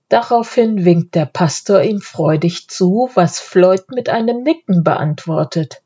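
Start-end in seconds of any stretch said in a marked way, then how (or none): none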